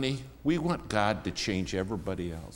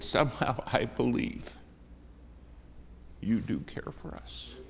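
moved, hushed; 3 semitones higher, 6 dB quieter than usual. Retold or imitated. Moved trucker's soft reply.

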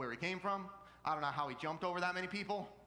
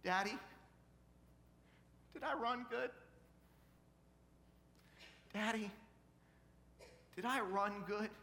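put-on higher-pitched little girl's plea; imitated tearful child; 6 semitones higher than usual. Daughter looking up at Daddy with tears, mildly tearful question. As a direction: voice a child pleading for approval, lightly tearful. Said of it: "with tears streaming down".

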